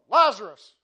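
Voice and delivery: Lazarus called out loud and long; yelled name. Retold or imitated. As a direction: shout Lazarus across the tomb. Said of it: "he yells out".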